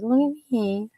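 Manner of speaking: a hint of a cute cat begging for attention. Voicing the affectionate cat coming up to her, lightly cutesy.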